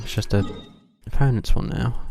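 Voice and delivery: normal talking voice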